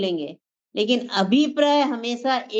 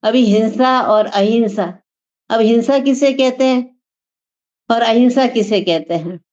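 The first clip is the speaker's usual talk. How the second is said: louder, driving sermon voice; full-force preaching; 6 dB louder than usual. Acted. Speaker urging the gathering about the soul, loud preaching. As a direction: preach it out to the hall.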